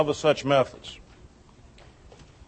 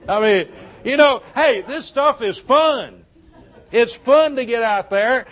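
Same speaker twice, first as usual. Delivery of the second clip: laughing; 5 semitones higher, 3 dB louder than usual. Real, not acted. Still laughing after the joke.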